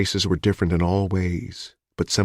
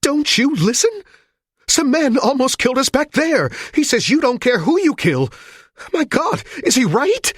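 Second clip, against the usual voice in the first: much higher and louder desperate yell; frantic shouting; 4 dB louder than usual. A narrator's reading. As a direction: shout at them in panic. Hostage shouting at police.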